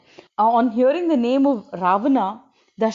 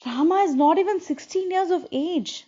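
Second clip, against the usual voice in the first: upset father; 7 semitones higher than usual. A narrator's reading. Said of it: "when he recovers, he's like".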